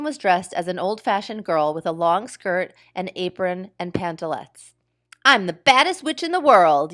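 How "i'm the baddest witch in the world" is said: higher, louder witch yell. A narrator's reading. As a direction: yell it across the playground. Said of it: "yelled Ramona".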